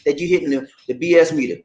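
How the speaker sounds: ordinary talk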